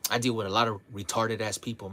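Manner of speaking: ordinary talk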